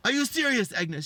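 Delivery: high, loud incredulous confrontation; outraged husband. Retold or imitated. Husband confronts his cheating wife on the phone, outraged. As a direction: act out a husband catching her out.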